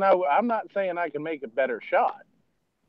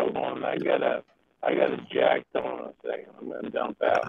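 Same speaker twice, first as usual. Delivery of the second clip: low robotic Cylon voice; distorted voice changer, pitched down; alien voice; 4 semitones lower than usual. Real, not acted. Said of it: "He sounds like a Cylon".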